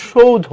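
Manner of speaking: "সৌধ" as shou-dho; সঠিক উচ্চারণ. Shown as a demonstration. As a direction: glide through ou in "সৌধ". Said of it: "সেটা সঠিক উচ্চারণ হবে". ("সৌধ" শৌ-ধো, প্রথমটা টেনে)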